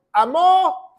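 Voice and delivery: "amó" with the final O hit hard and high; stress on the O. Shown as a demonstration. Teacher showing students the past tense, "amó" stressed on the final O. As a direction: raise and hold the accented O in "amó". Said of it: "con acento en la O".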